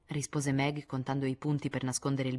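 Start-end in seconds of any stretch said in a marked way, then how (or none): none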